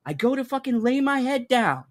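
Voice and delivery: ordinary talk, nothing marked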